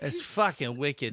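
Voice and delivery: slow Boston caricature; mock Boston accent. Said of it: "the Boston accent".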